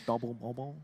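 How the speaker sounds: quiet laughing Scottish accent imitation. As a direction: echo it in a soft Scottish accent. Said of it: "said in a Scottish accent".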